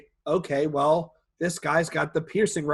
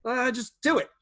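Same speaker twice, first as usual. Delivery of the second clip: nervous go-ahead; 8 semitones higher than usual. Retold or imitated. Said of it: "I'm like".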